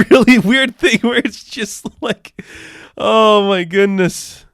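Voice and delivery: giddy laughing rant. Real, not acted. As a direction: crack up as you describe it. Laughing at a weird scene.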